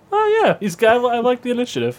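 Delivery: high, eager and suddenly keen; eager dean. Retold or imitated. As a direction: flip to eager approval, pitched high. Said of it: "instantly kinda goes into".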